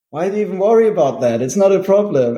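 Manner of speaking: high, fast, breezy mock brother; quick lighthearted dismissal; casual brother. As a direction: brush it off as nothing. Brother shrugging it off.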